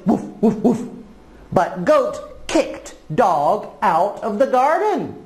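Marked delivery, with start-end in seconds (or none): 0.1-0.8 short imitated woofs; dog bark. Dog chasing the goat from the garden, imitated barking. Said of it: "Dog barked at Goat".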